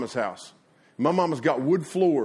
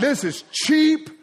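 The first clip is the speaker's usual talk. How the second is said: high, drawn-out amused delivery; gleeful onlookers; 9 semitones higher, 4 dB louder, much slower than usual. Retold or imitated. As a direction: relish the kids' wipeouts, stretching it slowly. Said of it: "We're like".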